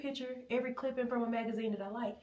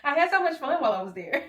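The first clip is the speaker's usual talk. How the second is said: loud, higher, laughing at your own joke; 8 semitones higher, 9 dB louder than usual. Real, not acted. Joking about visiting the North Pole, laughing loudly. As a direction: crack yourself up saying it.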